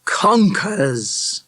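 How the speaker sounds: relishing it, drawn out. A narrator's reading. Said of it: "Mister Feinstein said with relish".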